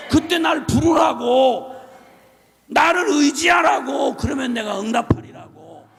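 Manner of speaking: loud, slow sermon cry; slow shouted preaching. Acted. Preacher calling the church to rely on God, slow shouted preaching. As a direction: drive each word home, slowly.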